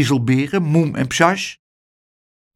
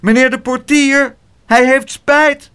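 calling out; 12 semitones higher, 6 dB louder than usual. A narrator's reading. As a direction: call out across the bushes. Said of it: "riep Pluk".

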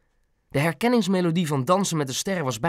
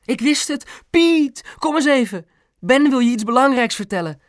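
very high, thrilled mother; excited calling; 11 semitones higher, 5 dB louder than usual. A narrator's reading. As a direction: call out to the husband in high excitement. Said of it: "riep Mam". (opgetogen, hoog roepend)

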